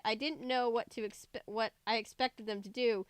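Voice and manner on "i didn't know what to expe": ordinary delivery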